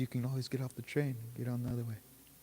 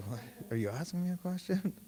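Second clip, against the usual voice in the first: wife's pointed question; 8 semitones higher than usual. Retold or imitated. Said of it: "She's like".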